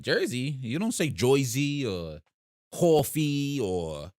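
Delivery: put-on "Joyzy" and "Coffee" drawn out slowly in a North Jersey accent; mock North Jersey accent. Mimicking how people say it up north, mock North Jersey accent. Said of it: "And they'd be like".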